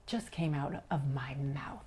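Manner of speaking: hushed, low disbelief; appalled whisper. Retold or imitated. Woman retells shock at what she just told him, appalled whisper. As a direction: whisper it, aghast at yourself. Horrified at her own words.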